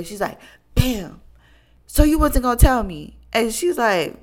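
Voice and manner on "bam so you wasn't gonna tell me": louder, higher "Bam. So you wasn't gonna tell me?" with a table slam; dramatic confrontation. Retold or imitated. Betrayed friend slams the table and accuses her, dramatically loud. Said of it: "dramatic, dramatic way".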